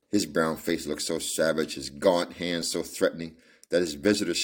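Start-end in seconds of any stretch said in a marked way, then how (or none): none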